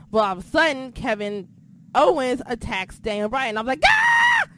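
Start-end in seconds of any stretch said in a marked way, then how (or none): 3.9-4.5 much higher, loud shriek; excited scream. Retold or imitated. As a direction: shriek like a fan in shock. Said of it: "I'm screaming".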